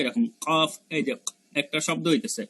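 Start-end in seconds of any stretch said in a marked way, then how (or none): 0.5-0.7 কফ with a hard back-of-throat click, like a cough; cough-like qaf. Shown as a demonstration. Teacher likening it to a cough, popping qaf. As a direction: strike কফ at the tongue root. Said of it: "কাশি দিলে যেরকম আওয়াজ হয়".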